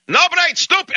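very high imitated Russian yell; hammed-up high-pitched Russian outburst; mock screaming Russian. Russian getting in everyone's face, mock screaming. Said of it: "he's screaming and yelling".

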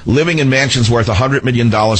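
normal talking voice

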